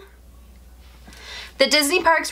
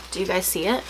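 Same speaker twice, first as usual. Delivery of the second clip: soft, fast hushed question; hushed excitement; 3 semitones lower, 6 dB quieter, faster than usual. Real, not acted. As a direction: lean in and hush it. Spotting the bracelet.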